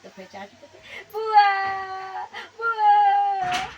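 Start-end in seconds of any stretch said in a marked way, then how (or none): 1.1-3.7 very high, very slow crying shout; crying wail. Acted. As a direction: stretch each word into a loud sob. Hurt boy crying for aunt. (रोते हुए बहुत खींचकर ज़ोर से चिल्लाना)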